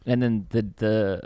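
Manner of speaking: usual speaking voice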